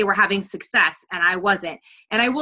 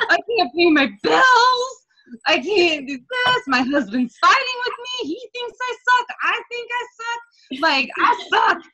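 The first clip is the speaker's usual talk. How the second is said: high, slow, tearful whimpering lament; retold crying; 10 semitones higher than usual. Coach reliving crying about bills and husband, tearful. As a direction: let it crack as you pile on the misery. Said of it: "on my couch crying, going".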